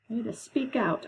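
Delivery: normal talking voice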